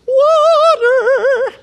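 high, slow, drawn-out discovery; awed reveal. Acted. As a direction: let the wonder rise as they see water. Dramatic reveal of water.

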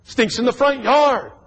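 wrinkle your nose at it; disgusted complaint. Retold or imitated. Man pacing the house, grossed out. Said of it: "he went outside and".

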